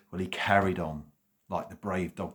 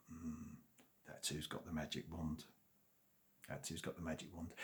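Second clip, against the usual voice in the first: much softer, secretive realisation; quiet realisation; 2 semitones lower, 15 dB quieter than usual. A narrator's reading. Dog and cat quietly realising who has the wand. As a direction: murmur it to yourself, plotting.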